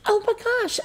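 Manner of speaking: very high excited gasp; delighted high exclamation. Retold or imitated. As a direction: squeal it out. Retelling her own excited reaction.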